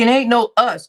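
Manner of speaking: loud, firm it ain't no us; loud firm denial to D; emphatic denial. Real, not acted. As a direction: shut it down loudly. Emphatic rebuttal.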